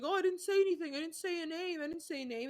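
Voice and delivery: high-pitched protesting imitation; mock defensive. Man denying he named anyone, mock defensive.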